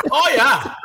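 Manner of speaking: high catchphrase impression; mimicking Tony. Cohost does producer's voice as he does all day, high mimicry. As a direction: pitch it up in a buddy's odd accent. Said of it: "saying it in his voice".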